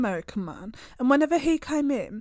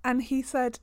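usual speaking voice

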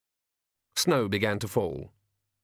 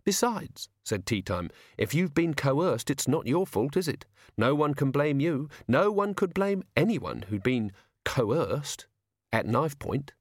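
put-on higher, cheerful voice of a polite young killer; sweetly reasonable menace, pitched up; polite menacing toff; 5 semitones higher, slower than usual. Young toff threatening a coachman with calm politeness.

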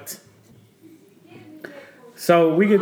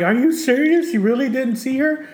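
disbelieving wife; 9 semitones higher than usual. Retold or imitated. Wife's disbelieving retort, retold.

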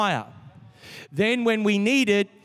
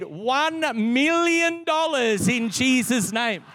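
slow, higher, grand proclaiming of a big sum; mock prophetic declaration; 4 semitones higher, slower than usual. Pastor imagining a big gift, proclaiming it prophetically. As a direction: pronounce it like a bold prophecy. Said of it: "I began to prophesy in my head".

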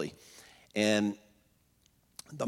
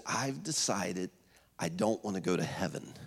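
retold whisper; 7 semitones lower, 6 dB quieter than usual. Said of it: "And we're whispering".

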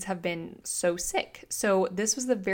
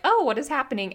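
flustered; 5 semitones higher, 4 dB louder, faster than usual. Retold or imitated. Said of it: "flustered and like".

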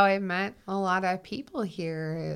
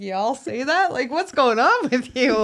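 bewildered fan; 6 semitones higher, 8 dB louder than usual. Retold or imitated. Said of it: "here's my Minnesota Knights looking around like".

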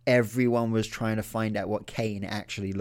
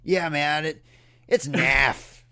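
mock American accent, a bit louder; put-on American accent; 3 semitones higher, 5 dB louder, slower than usual. Shown as a demonstration. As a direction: imitate a laid-back American accent saying it. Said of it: "I gotta do the accent".

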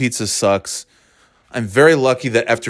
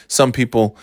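ordinary talk, nothing marked

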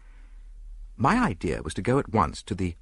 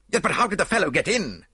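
high-pitched, urgent demand; agitated questioning; 10 semitones higher, 4 dB louder than usual. A narrator's reading. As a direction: ask it in agitation, voice raised. Distressed lord questions the theft.

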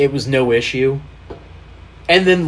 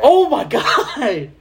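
high, drawn-out, laughing exclamation; amazed delight; 10 semitones higher, much slower than usual. Real, not acted. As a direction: stretch it out in real delighted disbelief. Delighted reaction to old clip.

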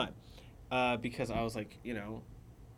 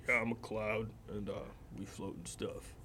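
mock low voice; 3 semitones lower, 8 dB quieter than usual. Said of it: "as deep as you could".

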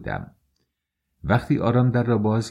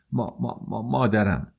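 put-on halting, tearful child's stammer; 3 semitones higher than usual. A narrator's reading. Weeping boy tells stranger about his mother, stammering tearfully. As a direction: stumble on the first syllable as if crying.

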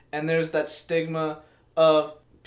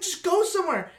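exasperated outburst; 10 semitones higher than usual. Retold or imitated. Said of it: "I'm like".

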